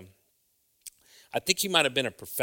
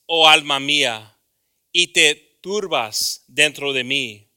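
loud, slow, higher proclaimed psalm; solemn scripture reading; 6 semitones higher, 14 dB louder, slower than usual. Real, not acted. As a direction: declaim the verse to the whole church. Reads Psalm 42 aloud.

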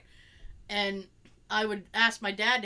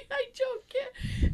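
put-on whiny slow child; 12 semitones higher, much slower than usual. Frustrated child.

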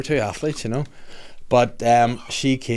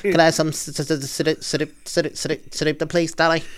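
stuttering lisp on the S, voice pitched higher; lisping child; 6 semitones higher than usual. Retold or imitated. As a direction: stumble over every S like a kid with a lisp. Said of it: "someone with a fucking lisp".

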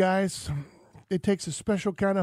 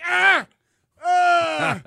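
high, loud "Ah!" yelps; re-enacted shock scream; 11 semitones higher, 7 dB louder than usual. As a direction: yelp like a jolt just hit you. Imitating his own electric shock.

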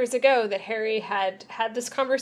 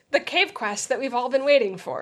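ordinary talk, nothing marked

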